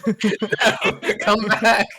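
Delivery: high, loud mock plea through laughter; playful, laughing shout; laughing call. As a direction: call them back while cracking up. Calling after a departing character, laughing.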